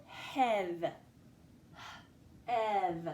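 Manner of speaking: "hev" said whole, then a puffed h and "Ev" apart; segmented syllable. Shown as a demonstration. Teacher breaking down the first syllable, "hev" then "Ev" sounded out. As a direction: sound out "hev" in separate pieces. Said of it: "I hear three sounds in the first syllable".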